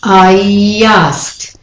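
I asked as I-yyyasked, drawn out; stretched y glide. Shown as a demonstration. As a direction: make asked begin with a y in I asked. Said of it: "as if there's a y".

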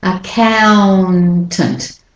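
"Accountant" very slow, lingering on "coun"; stressed syllable drawn out. Shown as a demonstration. Teacher showing learners the stress syllable, slow and stretched. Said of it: "So that's the stress syllable".

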